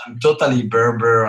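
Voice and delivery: ordinary delivery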